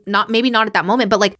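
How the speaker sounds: ordinary delivery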